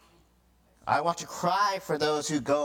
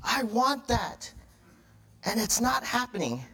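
mock tantrum; 6 semitones higher than usual. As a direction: stretch it out in a put-on fit. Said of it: "throw a tantrum up at the pulpit".

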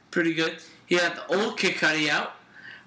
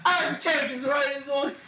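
high, hammed-up wail breaking into laughter; mock-sob through your own laughing; imitating crying pupil; 6 semitones higher than usual. Friend retelling Jordan crying in class, laughing mock wail. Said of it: "he's crying".

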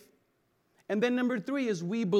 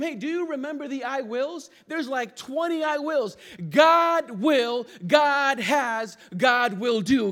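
loud, higher preacher's cry; preaching at full force; 5 semitones higher, 7 dB louder than usual. Acted. Pastor rousing the congregation, loud preaching. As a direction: lift it up and hammer each promise. Preacher voicing God's promises.

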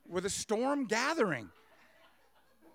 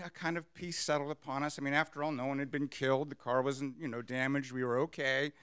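ordinary delivery

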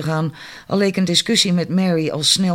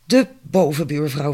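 ordinary talk, nothing marked

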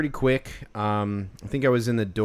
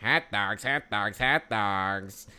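lightly nasal hot dog call, imitating the vendor; 3 semitones lower, much slower than usual. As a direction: mimic the hot dog seller's nasal chant. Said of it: "it was so nasally".

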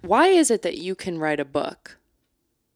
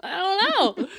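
laughing. Real, not acted. Laughing at own dodge.

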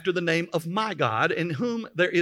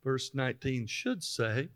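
low, slow, much softer; murmured aside; 7 semitones lower, 10 dB quieter than usual. Real, not acted. Preacher waiting on verse nineteen, murmured low and slow. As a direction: trail along quietly while waiting for the text.

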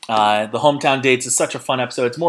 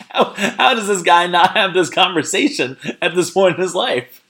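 laughing disbelief; 7 semitones higher, 4 dB louder than usual. Real, not acted. Laughing at Colton's question.

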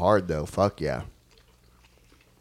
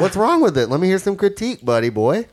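higher, louder teasing dare; mock challenge; 10 semitones higher, 6 dB louder than usual. Real, not acted. Daring his friend to trash the pizza, loud playful taunt. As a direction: egg him on to spill his critique, buddy.

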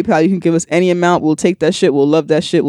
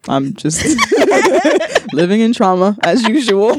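laughing; 5 semitones higher than usual. Real, not acted. Laughing through the line.

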